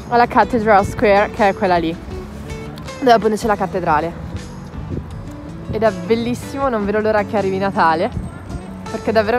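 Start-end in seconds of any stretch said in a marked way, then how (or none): none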